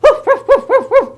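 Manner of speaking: high, loud, rapid barking; excited yapping; dog woof. Acted. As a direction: woof eagerly like a happy dog. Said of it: "And he's like".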